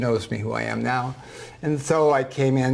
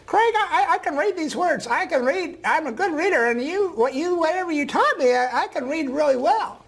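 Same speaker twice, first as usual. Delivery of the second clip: put-on old woman voice. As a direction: voice the ninety-four-year-old gently. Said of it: "I'm gonna use a voice that s-, sounds like her".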